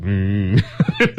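nasal buzzing insect hum; mosquito buzz. Pretend. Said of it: "and mosquitoes go".

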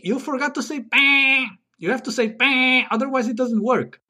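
high-pitched insistent scolding; exasperated reminder. Acted. Guest reminds host to say bang, exasperated. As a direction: pipe up in high protest.

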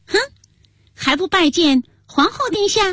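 high-pitched, imperious reprimand. A narrator's reading. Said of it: "大声喝道". (高声喝斥)

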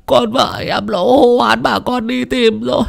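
high, slow, tearful begging; desperate pleading. Acted. Distraught father begging old Hà for help, tearful. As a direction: beg as if your life depends on it.

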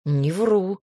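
grumbling, hurt and sulky; offended grumble. A narrator's reading. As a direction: mutter it like a sulking dragon. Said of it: "буркнул дракон обиженно".

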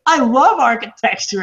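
high-pitched imitation of a child; kid. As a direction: play a keen kid actor declaring it. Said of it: "and he's like".

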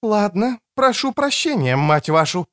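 high woman's retort. Acted. Rebuked woman snaps back at the man, high retort. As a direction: answer back in a high female voice.

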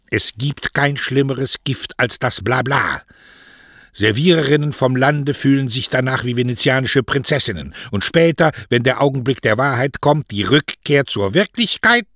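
high, loud, emphatic mother in full tirade; stern mother, warning. Acted. Mother warns her daughter against sweet talk, vehemently. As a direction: lay down the warning as an incensed mother.

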